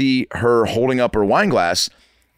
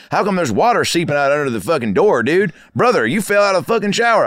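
higher-pitched Midwest military buddy; Midwest accent; 8 semitones higher than usual. Retold or imitated. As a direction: imitate the thick Midwest roommate. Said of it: "real thick, uh, Midwest accent".

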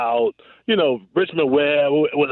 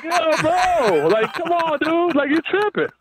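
much higher-pitched, pleading objection; incredulous protest; 12 semitones higher, slower than usual. Retold or imitated. Receiver to a defender going for the hit, incredulous. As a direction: protest in high-pitched disbelief, as if retelling it.

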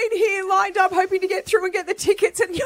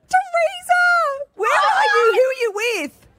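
excited call; 9 semitones higher than usual. Real, not acted. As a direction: call her name like she's already won. Host greets the contestant on air.

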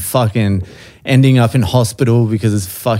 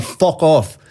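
imitated Irish dismissal, pitched up; Irish accent; 4 semitones higher than usual. Irishman rejects his explanation, mock Irish accent. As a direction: brush him off in a light Irish accent. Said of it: "in an Irish accent".

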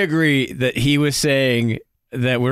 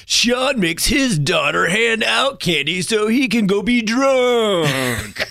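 slow, raised dramatic ad read; attack ad voice; 7 semitones higher, slower than usual. Pretend. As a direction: smear ad, cracking up at the end. Mock attack ad, laughing.

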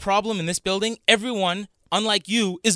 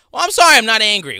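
higher and louder, exasperated; defensive outburst; 5 semitones higher, 10 dB louder than usual. Real, not acted. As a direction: snap back defensively. Defensive in argument.

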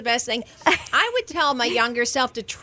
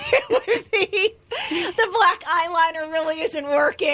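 laughing; 5 semitones higher than usual. Retold or imitated. Retelling a friend's funny advice.

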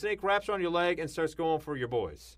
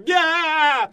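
high, loud startled yelp; freaked-out yelp; 12 semitones higher, 10 dB louder than usual. Retold or imitated. Driver freaking out at a spider, loud yelp. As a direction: shriek like a spider just ran across you. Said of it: "you go".

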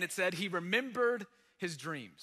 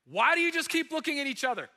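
fast, higher and louder, pressing his sons; a father snapping at idle sons; a hint of an exasperated father; urgent father; 7 semitones higher, 6 dB louder, faster than usual. Jacob voiced urging his sons.

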